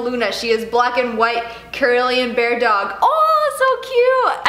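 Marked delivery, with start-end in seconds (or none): 3.0-4.5 high, slow gushing coo. Real, not acted. Fan letter about a dog, cooing so cute.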